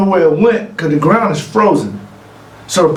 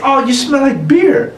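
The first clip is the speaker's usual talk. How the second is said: Favre's quip; 10 semitones higher than usual. Retold or imitated. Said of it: "He said".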